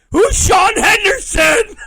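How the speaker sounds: loud, high squeal of disbelief; laughing shriek. Real, not acted. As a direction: shriek the question while cracking up. Cracking up in bafflement.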